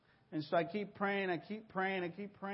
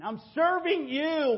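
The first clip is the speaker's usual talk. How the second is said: high, loud, slow protest; angry plea; 10 semitones higher, 8 dB louder, slower than usual. Retold or imitated. Complaining to God in his frustration, high loud plea. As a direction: demand an answer from God.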